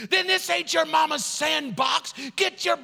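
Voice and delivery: high-pitched mock tirade; chief yelling. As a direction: bawl him out like a drill-hard chief. Chief explodes at recruit.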